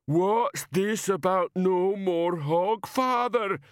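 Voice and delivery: very slow, high, wailing demand; big simple man getting worked up; upset slow giant. A narrator's reading. Banjo upset, demands answer.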